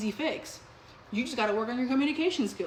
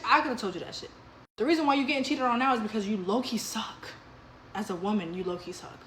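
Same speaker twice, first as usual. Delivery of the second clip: ordinary talk, nothing marked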